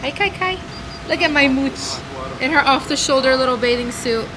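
high cooing voice. Real, not acted. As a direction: coo over the babies. Cooing at babies.

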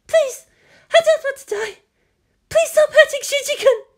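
high, loud, sobbing plea; tearful begging. A narrator's reading. Captive woman begging kidnapper to spare the boy, tearful. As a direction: beg desperately through tears. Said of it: "tears running freely".

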